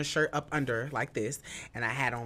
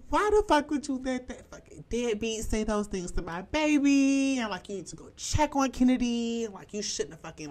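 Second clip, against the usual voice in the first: higher, louder mock-furious rant; imitating grandma cursing; 10 semitones higher, 5 dB louder than usual. Grandma cursing out mom on the phone, imitated furious. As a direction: tear into her like an angry grandma. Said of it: "cursing her out".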